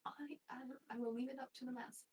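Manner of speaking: a short whispered hesitation; unsure whisper before answering. Acted. Unsure what metal to ask the smith for, whispered.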